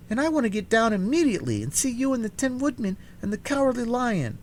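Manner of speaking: put-on high, quick girl's voice, rushing; eager little girl. A narrator's reading. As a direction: tumble the words out in a hurry to get down. Dorothy voiced in story.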